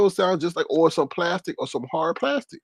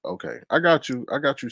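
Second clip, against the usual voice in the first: ordinary talk, nothing marked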